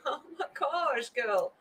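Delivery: soft, laughing through tears; touched to the point of tears; tearful laugh. Real, not acted. Streamer thanking a viewer, choking up through a laugh. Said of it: "I'm emotional at the moment".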